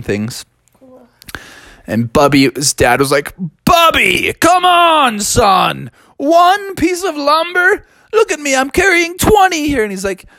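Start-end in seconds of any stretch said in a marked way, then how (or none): 3.6-9.8 scolding bear dad. Acted. Dad bear scolds Bubby for being pecked. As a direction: shout down at your son.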